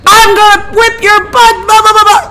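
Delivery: very high, loud mock threat; mom threatening. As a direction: yell a mom's threat. Mom threatening between calls.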